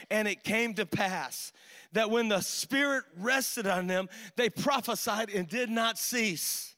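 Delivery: measured pulpit reading of Numbers; scripture reading. Real, not acted. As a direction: read the verses gravely to the church. Reads scripture aloud.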